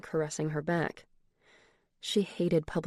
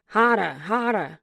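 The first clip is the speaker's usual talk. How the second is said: put-on slow, louder Boston-accented "Hotter, hotter"; 3 semitones higher, 6 dB louder, slower than usual. A narrator's reading. Boyfriend in bed, urging in a thick Boston accent. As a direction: drag out "Hotter" the Boston way, dropping the r. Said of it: "in that Boston accent".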